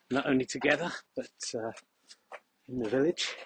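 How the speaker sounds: ordinary talk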